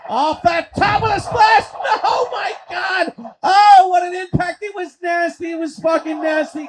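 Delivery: high, shouted wrestling call; stretched-out, excited ringside yell; shocked play-by-play. Acted. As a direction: react in shock to a brutal landing. Live call of big impact.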